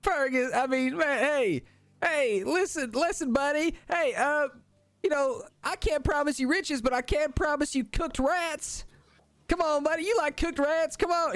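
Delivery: high squeaky kobold pleading; coaxing kobold. Acted. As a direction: tempt your buddy with cooked rats. GM voices kobold Dom coaxing.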